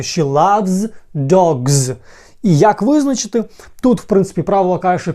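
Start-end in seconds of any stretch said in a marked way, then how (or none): 0.0-1.9 very slow, each word drawn out; slow model sentence. Shown as a demonstration. Teacher modelling the sentence slowly for students. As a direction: spell out She loves dogs slowly. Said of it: "правильно вимовляти".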